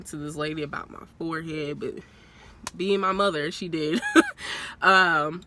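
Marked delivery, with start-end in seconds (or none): 4.1-4.8 high laugh. Real, not acted. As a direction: squeal of laughter. Laughing at mom story.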